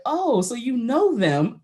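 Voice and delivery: hint of a sergeant toying with a recruit; lightly voiced drill sergeant. Drill sergeant questioning recruit about family, lightly imitated knowingness. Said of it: "my drill sergeant looked at me".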